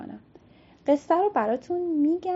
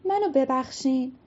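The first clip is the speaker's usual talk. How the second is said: higher, fast apology called out; pleading aloud; 10 semitones higher than usual. Acted. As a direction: beg forgiveness in a rush. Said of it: "بلند بهشون گفت".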